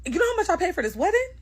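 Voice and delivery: sharp, loud and fast, pitched well up; an offended guest's loud, fast comeback; mean retort. Retold or imitated. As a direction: snap it back at her, loud and quick. The mean ones at the wedding.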